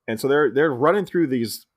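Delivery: usual speaking voice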